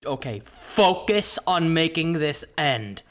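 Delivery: deliberate, tense pep talk to self; acted panicked focus. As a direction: talk yourself through mock panic. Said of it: "when I'm panicking, I'm like".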